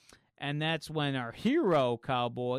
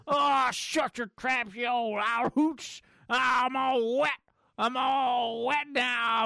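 louder, higher, slow cranky yell; mock old-man shout; 8 semitones higher, 5 dB louder, slower than usual. Soaked prospector yelling at the laughing heroes, hammed-up. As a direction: bark at the laughing crowd like a wet old coot. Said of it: "And they're all laughing at him".

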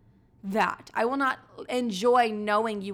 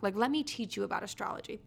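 usual speaking voice

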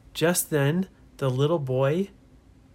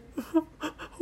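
put-on high breathy kid giggle; a quick high giggle; small boy giggling; little giggle; 14 semitones higher, 7 dB quieter than usual. Ben giggles.

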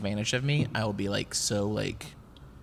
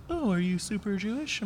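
quoted questioner; 9 semitones higher, 4 dB quieter than usual. Retold or imitated. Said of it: "people were always like".

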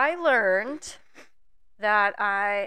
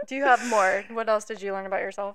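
usual speaking voice

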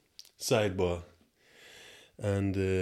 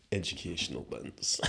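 ordinary talk

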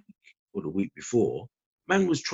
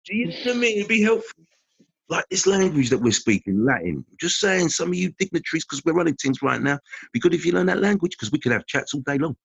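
mock Roman ruler; 9 semitones higher, 5 dB louder than usual. Romans voiced addressing conquered dignitaries.

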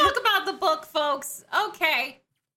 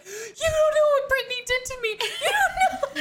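mock wailing; 9 semitones higher than usual. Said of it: "I shook my wife awake".

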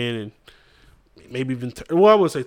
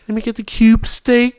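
higher, louder, slow ordering voice; mock greedy date; 10 semitones higher, 7 dB louder than usual. Imitating a date ordering pricey things, higher and louder.

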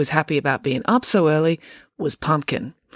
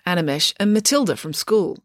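ordinary delivery, nothing marked

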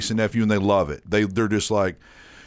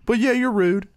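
high-pitched imitation of a kid; candid nephew; 12 semitones higher than usual. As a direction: answer matter-of-factly in a high child-like voice.

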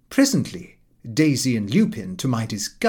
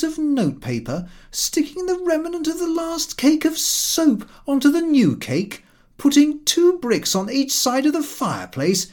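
Lupin's scornful rant; 11 semitones higher than usual. A narrator's reading. Lupin mocking Daisy's stingy father, scornful higher rant. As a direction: sneer through the list of his meanness. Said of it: "He answered".

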